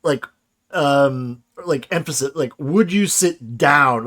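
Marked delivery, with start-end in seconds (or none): 2.6-4.0 "down" drawn out and emphasized; slow line with emphatic "down"; stress on "down". Shown as a demonstration. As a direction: lean slowly on the word "down". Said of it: "the way you emphasize down there".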